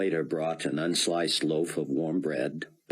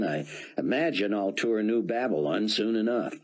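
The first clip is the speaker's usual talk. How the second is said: ordinary delivery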